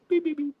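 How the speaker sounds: very high censor beep noises; bleeping out his own words; imitated bleeps. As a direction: squeak out a mock bleep. Bleeping himself out.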